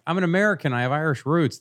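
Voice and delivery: high, bright eager tourist voice; eager American tourist. Retold or imitated. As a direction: play the excited American abroad. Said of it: "walking around going like".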